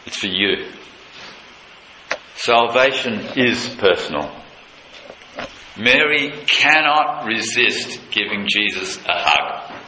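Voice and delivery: ordinary talk, nothing marked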